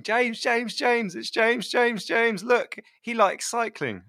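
put-on high, eager four-year-old voice; excited little kid. Niece showing her uncle the cycling doll, high childish excitement. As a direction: imitate a small child running up excited, pitched well up.

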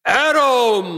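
loud booming divine call; boomy voice. Pretend. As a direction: call out like a god from above. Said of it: "like, boomy voice".

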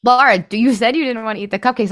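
high, loud imitation of a reproachful husband; nagging retold reproach; mock scolding. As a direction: pipe up with an exasperated reminder.

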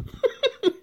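high, sudden burst of real laughter. Real, not acted. Laughing at "LOL" reply.

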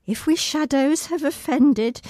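high, strained recitation; reciting through pain. Acted. Injured child actress reciting Puck's final speech. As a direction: push the lines out while hurting.

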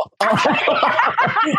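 squealing laugh of delight; high burst of laughter. Real, not acted. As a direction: laugh high and free at the joke. Laughs at luck story.

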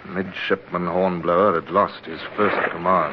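low, measured narration over music; story narration. A narrator's reading. Older Hornblower recalling his capture, in a reflective narrator voice. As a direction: tell it like a memoir, looking back.

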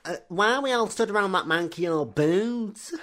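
high put-on boyish voice; Harry Potter impression. Mocking young Harry in the film's opening scene, high put-on voice. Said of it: "Harry Potter at start of Goblet of Fire".

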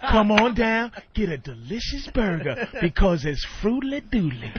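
a lightly voiced jazzy crooner. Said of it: "make it jazzy".

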